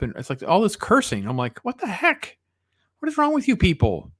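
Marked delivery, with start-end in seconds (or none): 1.6-4.1 much higher-pitched mock outrage; exasperated disbelief. Reviewer reacting to all the cursing in the song, exasperated. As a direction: pitch it way up, incredulous.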